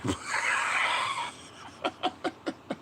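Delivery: long wheezing burst of laughter; big laugh. Real, not acted. Cracking up at his own wording, long breathless laugh.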